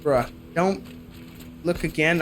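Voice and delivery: ordinary talk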